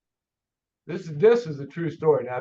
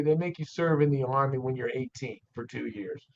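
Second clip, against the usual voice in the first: normal talking voice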